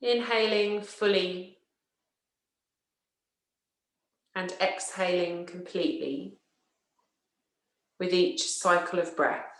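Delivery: slow, measured yoga nidra voice with long pauses; guided relaxation. Acted. Teacher settling listeners into yoga nidra, slow guided relaxation. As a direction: guide them unhurriedly into rest, leaving space after each phrase.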